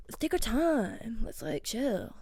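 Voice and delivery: soft, slow, chilled-out mimicry; laid-back Westerner. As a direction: imitate someone in no hurry, very softly. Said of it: "they're all like".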